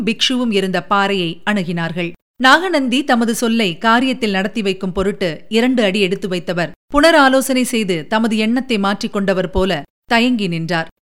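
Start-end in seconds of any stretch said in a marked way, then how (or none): none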